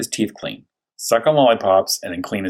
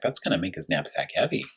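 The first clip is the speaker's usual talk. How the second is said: whispered aside; 7 dB quieter than usual. Acted. Aside about heavy knapsack.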